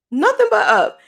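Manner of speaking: NOTHING but up thrown up high and lifted; emphatic repeat. Real, not acted. Telling listeners her life only goes up, triumphant. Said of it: "I feel deep down inside".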